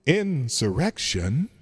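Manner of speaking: drawn out through a soundboard echo; echo effect. Shown as a demonstration. Host testing echo effects on the word, slow echoed. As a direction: stretch the word slowly into the echo. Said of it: "Let's try this one".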